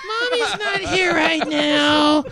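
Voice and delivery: put-on high, slow little child voice; mock child answering a call; kid voice. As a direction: play the small kid on the phone. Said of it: "I'm Otakon".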